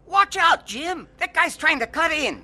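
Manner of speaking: sudden high, loud alarm; warning shout. Acted. Brother in the truck warns Jim about a car, alarmed shout. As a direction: yell a warning at the driver.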